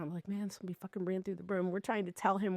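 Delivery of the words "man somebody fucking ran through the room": freaked-out babble. Retold or imitated. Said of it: "I was freaking out".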